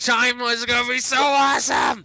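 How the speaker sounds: hammed-up fanboy shout, high and loud; mock excitement. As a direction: shout it like a wildly hyped fan. Mock fan gushing over new theme.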